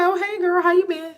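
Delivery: high, chirpy greeting; bright greeting. Retold or imitated. Greeting her neighbor in a high, cheery voice. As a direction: lift the voice high and friendly. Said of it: "I was like".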